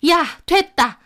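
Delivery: high-pitched, exasperated dismissal; fed-up mother-in-law. Acted. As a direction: cut the argument short. Mother-in-law cuts quarrel short. (말을 자르는 시어머니 목소리)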